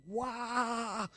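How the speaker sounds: high mock yelp of disbelief; a teasing cry, like he's lost it; mocking wah. Friends scoffing at his angel story, high mock yelp.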